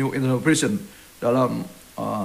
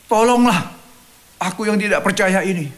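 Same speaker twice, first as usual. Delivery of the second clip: pleading cry; 6 semitones higher, 5 dB louder than usual. Retold or imitated. Father begs Jesus for help.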